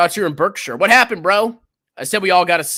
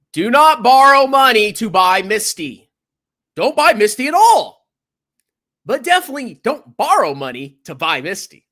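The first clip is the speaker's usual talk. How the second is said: firm warning; 7 semitones higher, 4 dB louder than usual. Real, not acted. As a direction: warn them loudly and firmly. Warning viewers firmly.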